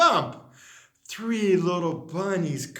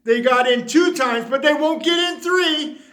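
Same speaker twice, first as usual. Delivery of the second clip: determined vow; 6 semitones higher, 5 dB louder than usual. Acted. Vowing to keep bunnies out.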